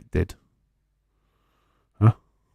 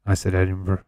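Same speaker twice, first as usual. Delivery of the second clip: ordinary talk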